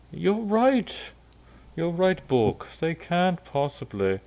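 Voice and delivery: high, fawning agreement; eagerly side with Bork; tiger leader agreeing. Acted. Tiger leader agrees with Bork, high and fawning.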